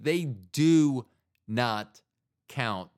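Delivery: they do NOT count, each word separate; slow and emphatic, do not count; stress on not count. Shown as a demonstration. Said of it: "I repeat".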